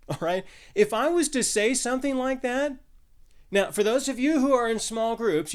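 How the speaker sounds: normal talking voice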